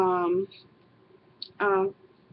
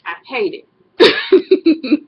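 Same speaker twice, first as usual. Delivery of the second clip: louder and much slower, dragging every word; emphatic loathing; 6 semitones higher, 10 dB louder, much slower than usual. Real, not acted. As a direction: really lean into how much you hate it. Emphatic complaint about long drives.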